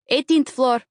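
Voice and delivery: put-on called out in Eastern European accent. A narrator's reading. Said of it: "he calls to me in an Eastern European accent".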